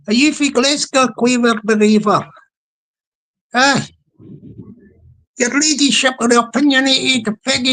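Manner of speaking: put-on Glaswegian chat voice; hammed-up Glasgow patter; attempted Glasgow accent. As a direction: have a go at a Glasgow accent, badly. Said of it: "turn on a wee Glasgow voice".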